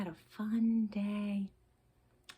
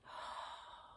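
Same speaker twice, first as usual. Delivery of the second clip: 1 soft sigh; 11 dB quieter than usual. Shown as a demonstration. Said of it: "Sigh a little bit".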